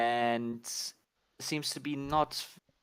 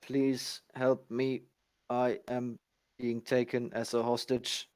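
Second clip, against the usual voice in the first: monotone robotic voice. Acted. Game master voicing a captive's plea, monotone robot voice. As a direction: plead word by word like a machine. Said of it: "he asks in a very monotone, uh, robotic voice".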